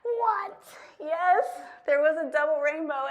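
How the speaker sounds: overjoyed by the rainbow; amazed. Acted. Amazed improv reaction.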